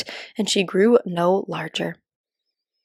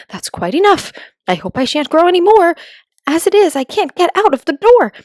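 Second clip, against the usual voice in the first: alarmed; 9 semitones higher, 6 dB louder than usual. Acted. Alice growing too big, alarmed.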